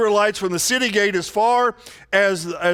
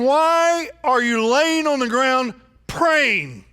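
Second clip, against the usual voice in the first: God's challenge; 6 semitones higher, slower than usual. Acted. God rebukes Joshua.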